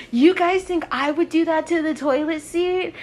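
high-pitched, affronted imitation; mock offended protest. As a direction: act out someone offended at being suspected. Girl protests being suspected of mess.

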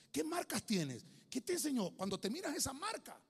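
high, loud, pressing questions; preaching at full force. Acted. Preacher pressing the congregation about their marks, loud and high. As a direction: drive each question at the church.

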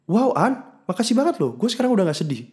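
high, overdone thankful voice; mock gushing gratitude. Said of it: "dia ngomong gue kayak".

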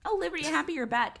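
high, delighted mock-granny greeting; very happy grandmother. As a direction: imitate a granny thrilled to have company. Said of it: "She was very happy".

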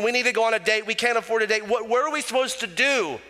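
high, fast worried outpouring; a stressed spouse fretting high and quick; anxious rant. Acted. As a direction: spill out the money panic in a rush. Voicing an anxious stressed-out couple.

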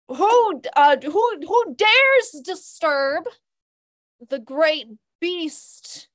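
slow, booming imp doing a scary voice; ancient rasping undead king. Acted. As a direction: put on a menacing monster voice. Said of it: "that wasn't very spooky".

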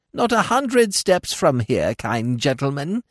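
high, slow droning crone; monotonous old woman. A narrator's reading. Old woman telling Smith where she lives, high slow drone. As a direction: drone it out like a weary beggar. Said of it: "she replied in a monotonous voice".

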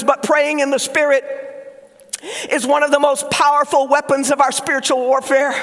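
ordinary delivery

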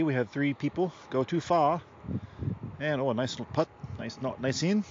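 put-on park golf commentary in a loose Australian accent; vaguely Australian accent. Narrating park golf for listeners, mock Australian accent. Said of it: "Don't know what accent this is. A little Australian".

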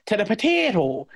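exaggerated Irish drag on "potato"; draggy Irish accent. Shown as a demonstration. Speaker showing what Walken won't commit to, draggy Irish accent. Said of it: "the dragginess of it".

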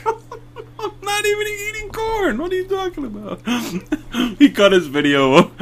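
high, laughing through the words; giggling denial, barely holding it. Real, not acted. Denies while cracking up.

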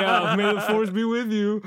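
slow, drawn-out sarcastic farewell, laughing; mock-sarcastic send-off; sarcastic Han. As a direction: toss it off with a sneer and a laugh. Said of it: "being sarcastic".

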